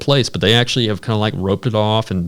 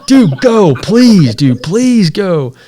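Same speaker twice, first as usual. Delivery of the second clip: high, loud, slow pleading; desperate begging; 8 semitones higher, 5 dB louder, much slower than usual. Retold or imitated. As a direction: beg desperately to leave. Begging to drive away.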